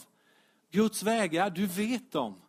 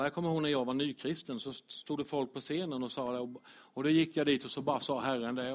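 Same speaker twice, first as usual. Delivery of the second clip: usual speaking voice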